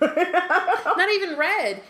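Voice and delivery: high, loud, slow laughing exclamation; slow, loud laughing outburst; laughing protest. Real, not acted. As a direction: laugh it out in disbelief.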